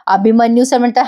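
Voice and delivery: ordinary talk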